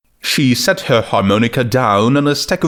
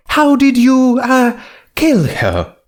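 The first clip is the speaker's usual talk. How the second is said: Rarity voice; 14 semitones higher than usual. Acted.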